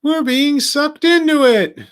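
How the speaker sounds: high, slow panicked yell; Jetfire yelling. Retold or imitated. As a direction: shout it up high like a panicking robot. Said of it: "do an impression of movie Jetfire yelling at everyone".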